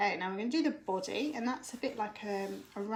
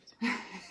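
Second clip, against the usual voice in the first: short laugh. Real, not acted. Children sneaking in mid-lesson.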